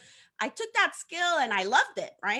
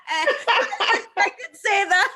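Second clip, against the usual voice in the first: laughing; 7 semitones higher, 5 dB louder, much slower than usual. Real, not acted. Laughing at own joke.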